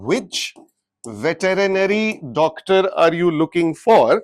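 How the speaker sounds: "Which" and "veterinary" slow, the two W and V sounds kept apart; slow example reading. Shown as a demonstration. Teacher reading the example sentence slowly. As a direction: read out the example slowly, showing each opening sound. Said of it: "इसका pronunciation व करके होता है".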